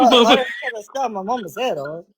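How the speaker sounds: laughing through a mocking quote. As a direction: giggle the line out. Repeating quote while laughing.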